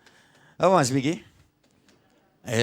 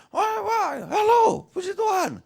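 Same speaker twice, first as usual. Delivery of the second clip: high, airy, showy pastor greeting everyone; mock pastor greeting; slower than usual. Preacher mocks a dreamy pastor greeting people, high-pitched and theatrical. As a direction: ham up a pastor greeting his flock in the hall. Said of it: "Pak Pendeta itu kalau jalan gimana?".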